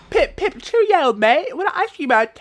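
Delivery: high, loud caricature British squawk; mock British accent. Throwing British catchphrases back at them, mocking British accent. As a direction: jeer in an exaggerated British accent.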